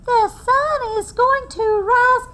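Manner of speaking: high, slow squeaky little squirrel voice; piping squirrel. Acted. Storyteller voicing Little Brown Squirrel to children: high piping. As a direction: pipe it up like a tiny squirrel. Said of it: "Little Brown Squirrel piped up".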